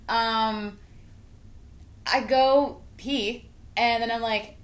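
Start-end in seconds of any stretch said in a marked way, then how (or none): none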